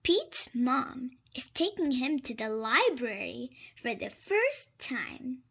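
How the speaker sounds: ordinary delivery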